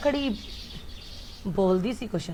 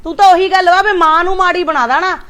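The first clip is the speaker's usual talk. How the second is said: higher and louder, hurt scolding; angry mother; 6 semitones higher, 5 dB louder than usual. Acted. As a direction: lash out at the son in wounded anger. Mother angry quarrel with son. (ਗੁੱਸੇ ਵਿੱਚ ਉੱਚੀ ਆਵਾਜ਼)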